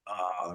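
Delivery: low, drawn-out groan. Retold or imitated. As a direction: let out a soft, long groan. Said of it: "we do, like, a groan".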